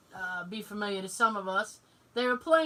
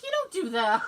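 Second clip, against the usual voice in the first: higher-pitched scolding through a laugh; laughing reproach; 7 semitones higher than usual. Retold or imitated. Daughter correcting dad about the game, laughing reproach. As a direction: scold while giggling.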